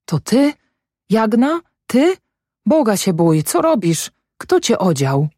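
high, startled questions; shocked to find her out in the cold; startled husband. Acted. Husband startled to see his sick wife outside, high and alarmed.

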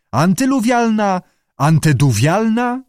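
"Antyluwialna", "Anteduwialna" each tried as a high question; questioning attempts. A narrator's reading. Fred guesses at the word.

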